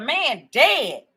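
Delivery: usual speaking voice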